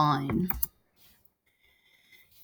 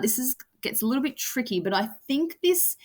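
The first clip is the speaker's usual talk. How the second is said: ordinary talk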